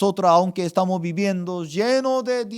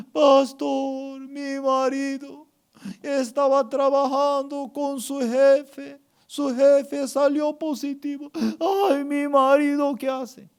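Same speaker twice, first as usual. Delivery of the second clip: high, tearful mock wailing; imitated crying; 7 semitones higher than usual. Sister phones pastor about her husband, acted crying. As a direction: sob through it like a frantic wife. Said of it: "estaba llorando".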